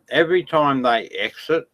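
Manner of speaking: ordinary delivery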